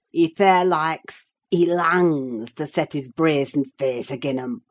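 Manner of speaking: put-on broad, rustic Yorkshire accent; Joseph's Yorkshire dialect. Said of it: "it was Joseph who told me".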